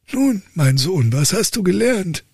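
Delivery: high, exasperated father voice; old count lecturing his dull son; stern old count. Acted. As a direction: play the despairing old father.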